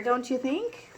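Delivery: usual speaking voice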